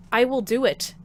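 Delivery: usual speaking voice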